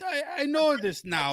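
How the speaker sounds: a hint of a comic Russian; fading Russian accent; mock Russian accent. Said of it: "sliding out of Russian so fast with that accent".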